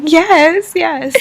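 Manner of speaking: high-pitched, overjoyed reply; emotional acceptance. Retold or imitated. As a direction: blurt out your answer, overcome. Acts out accepting the proposal.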